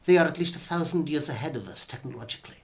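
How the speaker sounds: acted film-scientist line; Professor Quatermass. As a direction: deliver a grave sci-fi warning, theatrically. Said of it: "Or Professor Quatermass".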